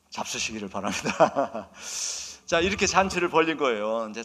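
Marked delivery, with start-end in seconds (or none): 0.1-2.5 amused, breaking into a laugh. Real, not acted. Preacher joking about eating prized veal, laughing. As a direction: say it through a chuckle and let it spill over.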